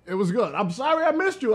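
ordinary delivery

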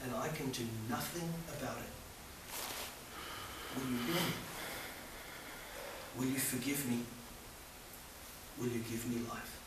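humble sinner's prayer. Acted. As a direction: pray it quietly, like a sinner asking forgiveness. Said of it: "when you said to him".

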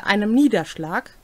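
ordinary talk

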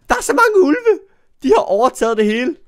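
high, loud squeal of alarm; excited squeal. Real, not acted. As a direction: squeal at all the wolves. Spots a pack of wolves. (højt begejstret hvin)

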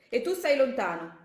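sudden forceful cry of warning; shouted warning. Acted. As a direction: shout him back to keep his distance. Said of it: "urlò il ragazzo".

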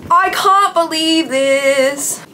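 high, slow stretched squeal; giddy disbelief. Real, not acted. Fan getting ready for the concert, giddy. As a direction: draw it out in happy disbelief. Overwhelmed joy.

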